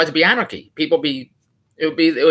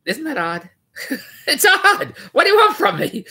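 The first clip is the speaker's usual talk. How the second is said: laughing disbelief; 12 semitones higher than usual. Real, not acted.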